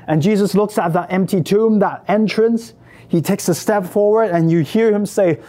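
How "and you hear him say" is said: plain reading voice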